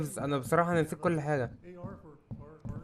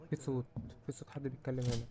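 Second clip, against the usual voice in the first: much softer, hushed alert; 2 semitones lower, 11 dB quieter than usual. Real, not acted. Gamer noticing a voice nearby in the level, hushed. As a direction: drop the voice to listen.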